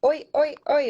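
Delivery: high, loud punched cheer; imitated crowd yell. Crowd answering the Aussie cheer, imitated high yell. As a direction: yell back like a parade crowd. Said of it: "other people in the crowd would yell".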